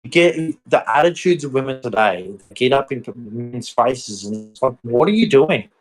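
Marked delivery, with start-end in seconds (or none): 4.8-5.7 exasperated challenge. Retold or imitated. Acted reaction to confrontation.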